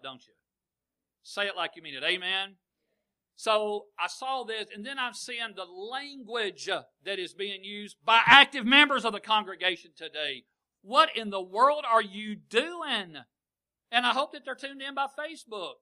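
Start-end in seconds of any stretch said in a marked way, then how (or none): 3.4-10.4 high, loud preacher's cry; full-force sermon, high and loud; fiery preaching. Acted. 10.8-13.2 high, loud and very slow rebuke. Acted. Preacher rebukes members, slow shout.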